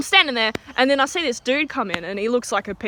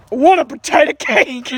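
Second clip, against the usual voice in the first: gruff menacing offer, louder; tough guy glaring; 3 semitones higher, 6 dB louder than usual. Retold or imitated. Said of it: "glaring daggers ... he goes".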